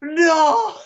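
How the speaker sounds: a very high, loud wail of no; hammed-up despairing no; mock anguished cry. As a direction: ham up grief at a cartoon death. Mock grief over Optimus dying.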